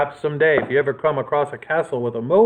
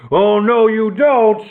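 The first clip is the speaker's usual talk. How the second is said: louder, higher shout; 7 semitones higher, 4 dB louder, slower than usual. A narrator's reading. Robber chief grabs Nick at the window, shouting. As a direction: stop him in his tracks. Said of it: "grabbed him by the collar and shouted".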